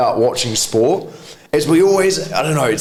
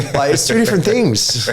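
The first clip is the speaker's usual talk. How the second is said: ordinary talk, nothing marked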